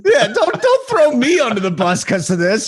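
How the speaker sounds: high-pitched protest through a laugh. Real, not acted. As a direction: object to the blame while laughing. Laughing protest at being blamed.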